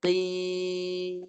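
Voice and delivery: "Qim" held long, ending in a nasal hum; pressed into nose. Shown as a demonstration. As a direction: push the long final m of "Qim" through the nose. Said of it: "Tekan kat hidung".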